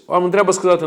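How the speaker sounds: ordinary talk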